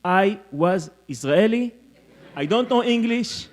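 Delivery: hammed-up Israeli English; Israeli accent. Shown as a demonstration. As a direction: show off his old Israeli accent. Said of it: "I used to speak English like this".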